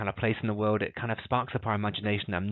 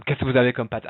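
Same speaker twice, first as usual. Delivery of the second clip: louder, assertive French question; aggressive, assertive; 2 semitones higher, 7 dB louder than usual. Shown as a demonstration. Teacher demonstrating a dialogue reading, aggressive and assertive. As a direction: put the question forcefully. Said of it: "a bit more aggressive and assertive".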